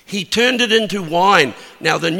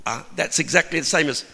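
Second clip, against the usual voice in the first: ordinary talk, nothing marked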